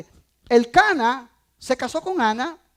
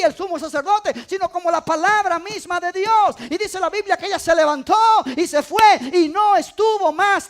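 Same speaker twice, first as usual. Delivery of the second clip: higher, louder fervent sermon with rising aleluyas; full-force preaching; 7 semitones higher, 7 dB louder than usual. Acted. Pastor exhorting the church about God's word, fervent and loud.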